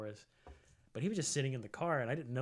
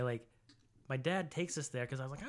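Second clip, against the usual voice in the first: usual speaking voice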